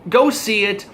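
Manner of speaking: normal talking voice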